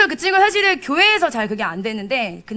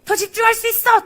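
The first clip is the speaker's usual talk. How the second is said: loud, high call to pray harder; urging shout; 10 semitones higher, 8 dB louder than usual. Retold or imitated. Pastor shouting to push a student to focus. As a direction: push him on with a ringing cry. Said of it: "딱 그랬는데".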